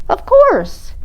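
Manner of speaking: high, sweet penguin voice; penguin kid voice. Acted. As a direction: answer like a cute penguin. Penguin character reply.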